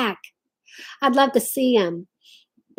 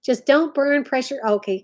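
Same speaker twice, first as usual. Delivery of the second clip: ordinary talk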